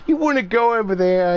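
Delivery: pet talk. Retold or imitated. Showing how they talk to their cat, in quick cooing pet talk. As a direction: coax the cat along brightly.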